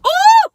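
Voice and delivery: a high, loud scandalized whoop; outraged ooh. Retold or imitated. Friend hearing the hospital breakup, scandalized loud whoop. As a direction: whoop like you can't believe it. Reaction shout after outrage.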